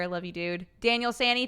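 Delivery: ordinary delivery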